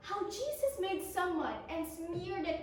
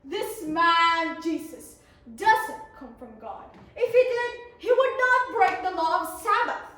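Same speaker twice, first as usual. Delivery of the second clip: annoyed; 9 dB louder than usual. Acted. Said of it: "The Pharisees got very annoyed and said".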